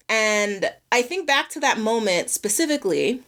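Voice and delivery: ordinary talk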